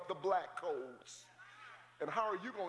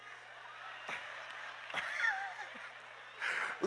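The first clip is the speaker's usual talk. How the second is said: wheezing squeal of laughter; helpless laughing fit; high silent laugh; 4 dB quieter than usual. Real, not acted. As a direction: laugh it off breathless. Laughs at the photo.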